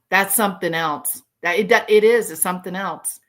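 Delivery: usual speaking voice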